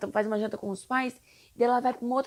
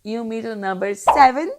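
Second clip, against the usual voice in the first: louder fresh restart; 9 dB louder than usual. Real, not acted. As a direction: say it again much louder, starting over. Restarts the line after a slip. (recomeço bem mais alto)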